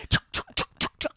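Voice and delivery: whispered chopping noises; scissor chop sounds. Retold or imitated. Wife imitating her own scissors chopping, whispered chuks. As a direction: snip the air with breathy chuks. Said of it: "I was like".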